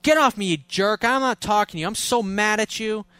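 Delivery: mock-angry girlfriend yelling at her boyfriend. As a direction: play the girlfriend pushing him away, hammed up. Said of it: "She says".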